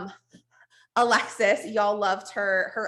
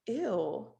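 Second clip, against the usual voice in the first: disgusted; 2 semitones lower, 8 dB quieter than usual. Retold or imitated. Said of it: "I was like".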